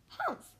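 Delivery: high, loud "humph"; scornful humph. A narrator's reading. A voice scoffing at Horton, high indignant humph. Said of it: "humped a voice".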